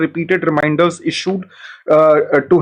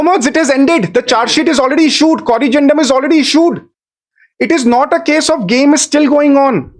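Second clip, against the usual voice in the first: higher and urgent, pressing the point; heated argument; 7 semitones higher than usual. Real, not acted. Counsel insisting to the judges the case has ended, raised and urgent. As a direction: push back hard, the game has ended.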